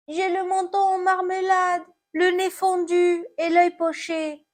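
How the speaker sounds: high, plaintive apple voice; hurt apple. Acted. Fallen apple answers about its injuries, high lament.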